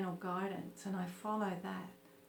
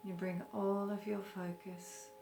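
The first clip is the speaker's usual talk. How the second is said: guided meditation; 7 dB quieter than usual. Acted. Teacher leading listeners through a short breathing meditation, calm guided meditation. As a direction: gently guide them into stillness, unhurried.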